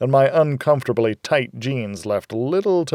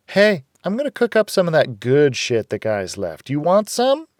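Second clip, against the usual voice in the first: higher, brisk woman; a hint of Rita, higher; Rita's voice; 6 semitones higher, 3 dB louder than usual. A narrator's reading. Rita telling the girl plans changed, higher.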